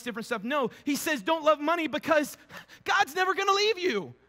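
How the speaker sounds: loud, high sermon delivery, driving the verses home; full-force preaching. Acted. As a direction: preach the verse with fire to the congregation.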